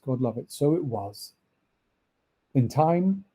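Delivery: usual speaking voice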